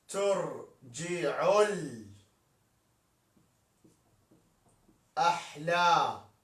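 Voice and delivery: word by word, very slowly, stretched out; slow scansion. Shown as a demonstration. Teacher scanning a verse line for students, slow and drawn out. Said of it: "teacher scanning verse slowly".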